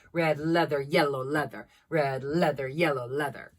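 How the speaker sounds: "Red leather, yellow leather" spoken with weighted L sounds; leaning on Ls. Shown as a demonstration. Teacher's tip for tongue twisters, "leather" and "yellow" with leaned-on Ls. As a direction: lean on every L in "leather" and "yellow". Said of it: "leaning on all of the L sounds".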